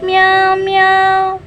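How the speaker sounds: high singsong meow meow; cat meow. Shown as a demonstration. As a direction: mew like a kitten. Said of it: "Can you say it again?".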